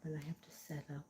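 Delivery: a quick whispered aside while busy setting up. Real, not acted. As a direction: drop to a breathy whisper, just for yourself.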